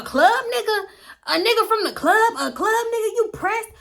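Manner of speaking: very high, mocking disbelief; mocking a rival's jealousy, pitched high; incredulous. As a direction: scoff in shocked disbelief. Incredulous retort in quarrel.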